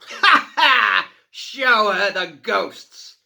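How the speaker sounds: loud, high, slow fiendish call; gleeful cry. A narrator's reading. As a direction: shout the order with glee. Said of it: "he cried".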